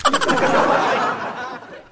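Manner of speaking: high laugh trailing down. Real, not acted. Host laughing at the old lady joke, high laugh. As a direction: laugh at the twist.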